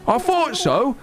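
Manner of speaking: high, chirpy British shopkeeper; cheeky British accent. Acted. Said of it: "I guess he's more like".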